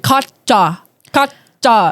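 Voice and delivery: "Katja" split into two halves, KOT-chah; each "Katja" stiffly broken after the first syllable; imitated pronunciation guide. Speaker mimicking a recording that says her name oddly, imitated guide. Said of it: "was saying it crazy".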